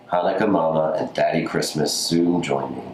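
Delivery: ordinary delivery, nothing marked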